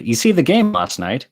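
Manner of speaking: loud and breezy for show; asked aloud. Acted. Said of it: "The stranger asked aloud".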